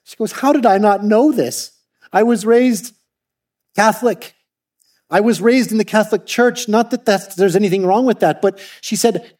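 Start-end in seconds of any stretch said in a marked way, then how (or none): 0.3-8.4 angry. Retold or imitated. Louisa telling the pastor she was never taught, angrily. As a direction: let the outrage spill out. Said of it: "She was angry".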